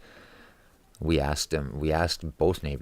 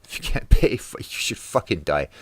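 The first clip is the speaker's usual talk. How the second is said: laughing; 4 semitones higher, 8 dB louder than usual. Real, not acted. Laughing at own dark joke.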